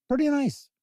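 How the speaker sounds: pretty nice jumps way up high; a playful high-pitched take; squeaky pretty nice. Pretend. Playful repeat of own remark.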